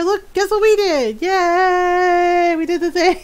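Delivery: high, bright celebration; excited cheer. Pretend. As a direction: cheer it proudly. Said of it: "tell her".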